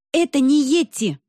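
joyful exclamation. A narrator's reading. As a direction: blurt it out with sudden joy. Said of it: "радостно выдала я вслух". (радостно выпалить вслух)

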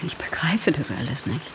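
whispered in disbelief; hushed, shaken whisper; stunned whisper. Acted. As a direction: barely voice the words, overwhelmed. Shocked sister meets lost sibling.

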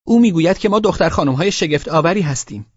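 put-on high-pitched child explaining eagerly; excited little girl. A narrator's reading. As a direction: repeat it to papa in a high girlish voice. Girls repeat the joke to papa. (صدای زیر دختربچه)

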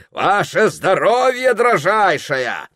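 high, loud, slow toast; gallant shouted toast. A narrator's reading. Officer toasting the ladies at table, gallantly shouting. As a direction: call it out grandly, drawing the words out. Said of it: "крикнул он".

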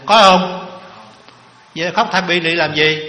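preacher calling out to the hall; loud rhetorical challenge. Real, not acted.

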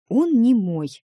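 pouting retort; sulky. A narrator's reading. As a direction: object huffily, leaning on the first word. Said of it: "насупилась я".

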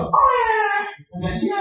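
put-on high baby voice answering; mock infant reply, pitched high; imitated baby. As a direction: squeak it out like a crying infant. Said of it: "tanya sama bayi-bayi yang lain".

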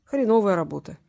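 ordinary delivery, nothing marked

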